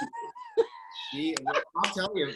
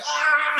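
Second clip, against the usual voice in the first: startled yell; 9 dB louder than usual. Retold or imitated. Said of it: "Or I'm like".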